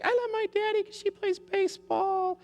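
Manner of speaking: put-on very high squeaky child voice; little girl voice. Preschool girl reading her daddy card, squeaky child voice. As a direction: chirp it like a small preschool girl. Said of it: "the little girl's like".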